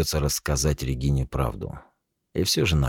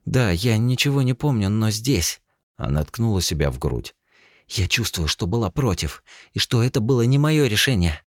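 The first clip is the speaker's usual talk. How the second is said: insistent; 7 semitones higher than usual. Acted. Insisting on her feelings.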